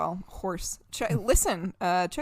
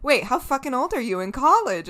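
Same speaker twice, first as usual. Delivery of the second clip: louder, higher incredulous retort; retold disbelief; 6 semitones higher, 7 dB louder than usual. Host replaying a reaction to an underage drinker, mock incredulous. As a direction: act out mild shock. Retold shocked reply about age.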